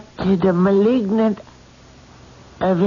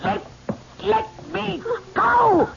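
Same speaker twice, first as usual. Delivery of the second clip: terrified screaming; 11 semitones higher, 5 dB quieter, faster than usual. Acted. Medium possessed, screaming to escape.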